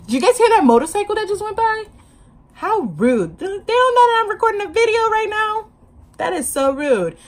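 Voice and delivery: high-pitched playful outrage; hammed-up affront at a noisy interruption; mock indignation. Mock complaint about passing motorcycle.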